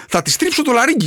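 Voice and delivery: normal talking voice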